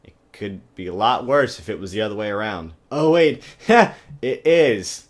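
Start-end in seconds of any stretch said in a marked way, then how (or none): 3.7-4.2 high loud laugh at own joke; sudden loud laugh; burst of laughter. Real, not acted. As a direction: crack up at the punchline.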